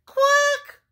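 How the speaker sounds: very high, loud quack; piercingly high alarm quack; duckling cry. A narrator's reading. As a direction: squawk like a frightened duckling. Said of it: "cried number five".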